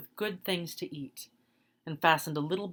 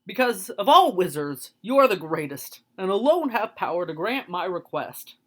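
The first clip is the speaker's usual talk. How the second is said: loud eager lion; 3 semitones higher, 7 dB louder than usual. Acted. Lion flatters the great wizard, loud and eager. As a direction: proclaim his flattery boldly.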